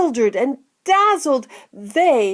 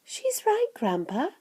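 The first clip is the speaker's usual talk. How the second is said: put-on soft, high boy's voice; a touch of breathless discovery, high and soft; hushed excitement; 8 semitones higher, 7 dB quieter than usual. A narrator's reading. Charlie telling Grandpa what he sees, mildly excited. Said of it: "cried Charlie".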